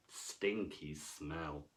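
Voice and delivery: slow, low, soft stinky smell; slow, disgusted low voice; mock disgust. A narrator's reading. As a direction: drag it out with a pulled-face disgust. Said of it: "comic low delivery".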